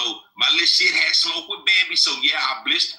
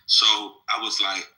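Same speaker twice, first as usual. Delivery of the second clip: usual speaking voice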